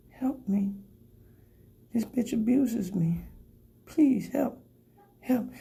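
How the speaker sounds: high, soft pleading cry for help; pleading couch voice. Acted. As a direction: beg for rescue in a tiny voice. Couch voiced as abused victim.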